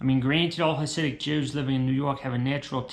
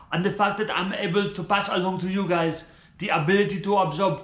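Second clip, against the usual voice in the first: preachy spiritual guru; 4 semitones higher than usual. Acted. Guru addressing followers about blessings, reverent and preachy. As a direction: preach like a self-help prophet.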